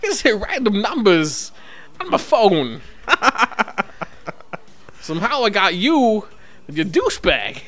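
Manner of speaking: prank-call someone as Walken; Christopher Walken. Pretend. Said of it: "It's actually Christopher Walken".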